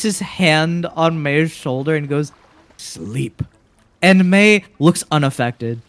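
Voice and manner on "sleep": very low, soft spell word; hushed command. Acted. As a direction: murmur it like a spell. Eldorus casts a sleep spell.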